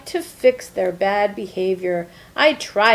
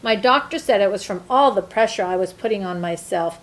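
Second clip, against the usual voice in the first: usual speaking voice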